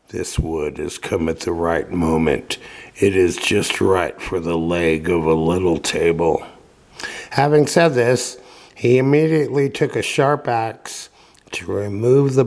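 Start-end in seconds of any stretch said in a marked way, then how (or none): 0.1-6.4 a hint of a low old man, murmured to himself; lightly voiced old carpenter, soft. A narrator's reading. Old carpenter talking to himself over wood, softly low. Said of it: "he said softly to himself".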